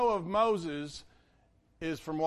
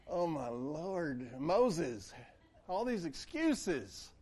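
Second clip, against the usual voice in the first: slow, softer, weary sigh of a voice; exasperated God; 3 semitones lower, 5 dB quieter, much slower than usual. Retold or imitated. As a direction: play God fed up with Moses' excuses. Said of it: "the Lord gets exasperated with us".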